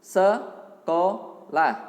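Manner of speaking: very slow, loud, syllable-stretched; sounding out words. Shown as a demonstration. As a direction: stretch each syllable loudly for the class. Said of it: "Sekali lagi". (mengeja pelan per suku kata)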